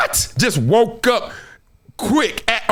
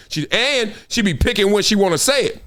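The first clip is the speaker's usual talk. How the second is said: ordinary delivery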